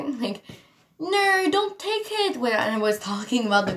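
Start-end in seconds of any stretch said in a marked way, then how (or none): 1.0-2.4 high-pitched mock-British protest; British accent. Vlogger imitating herself at the board game, British accent. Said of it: "like, a British accent a little bit".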